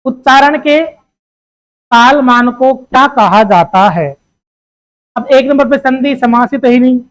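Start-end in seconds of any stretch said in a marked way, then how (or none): 0.0-4.1 reading the question out slowly, word by word. Shown as a demonstration. Teacher reading the exam question aloud to students, slowly. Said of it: "अगला question है".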